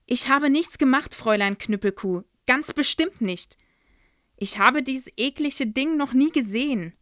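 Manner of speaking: put-on girl defends herself to headmistress; pleading denial. A narrator's reading. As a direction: insist on innocence. Child denies accusation.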